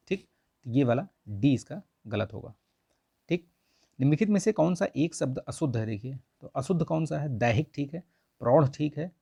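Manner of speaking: ordinary delivery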